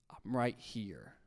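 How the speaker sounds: low, slow, very soft voice of God; gentle inner voice. Retold or imitated. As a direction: reassure softly and slowly. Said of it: "is this voice saying".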